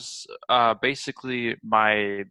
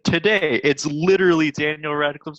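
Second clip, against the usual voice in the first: higher, louder burst of discovery; excited realisation; 5 semitones higher, 3 dB louder than usual. Real, not acted. Host telling co-host of the actor's birthday today, excited. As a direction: blurt out the surprising news, excited.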